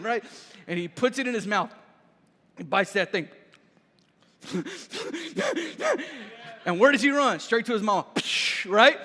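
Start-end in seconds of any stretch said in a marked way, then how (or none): 4.5-6.6 high, soft puffing breaths; imitated hot-pepper panting. Little cousin has bitten a hot pepper, imitated panting. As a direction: mime a burning mouth with quick little puffs.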